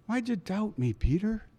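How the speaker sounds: soft, hurt question. Retold or imitated. Jesus gently asking Peter, soft and hurt. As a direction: ask it gently, wounded. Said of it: "I think he's kinda hurt".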